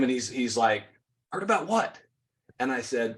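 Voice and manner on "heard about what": taken aback, voice jumping up; startled. Retold or imitated. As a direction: imitate a man caught off guard. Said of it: "it kinda startled him".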